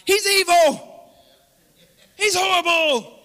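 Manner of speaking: high, drawn-out mock yell; mock accusing shout. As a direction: bellow it like an outraged accuser. Mock outcry against God.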